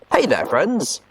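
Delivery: plain reading voice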